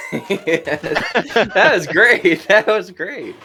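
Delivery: cracking up with approval; real laughing. Real, not acted. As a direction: laugh out the praise. Cracking up after the speech.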